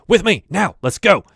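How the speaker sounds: rally the guards with urgency; guard ordering his men to escort the lord; commanding order. Acted. Guard ordering three other guards.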